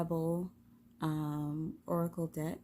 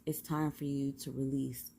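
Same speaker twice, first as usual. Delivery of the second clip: ordinary talk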